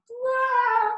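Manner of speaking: sudden high, loud mock wail; a hammed-up burst of emotional overwhelm. Acting out feeling overwhelmed, scattered.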